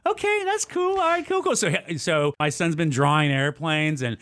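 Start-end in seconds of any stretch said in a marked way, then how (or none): 0.1-1.6 high reaction. Retold or imitated. Dad reacting to son's plan.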